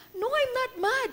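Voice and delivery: high, clipped-sounding protest of "not mad"; defensive denial. Retold or imitated. Mother answering her son Rafa, high defensive denial. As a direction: deny it a bit too sharply. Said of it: "Rafa started telling me".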